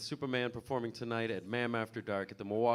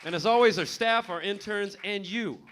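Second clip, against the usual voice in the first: loud; 5 semitones higher, 8 dB louder than usual. Real, not acted. Host shouting thanks.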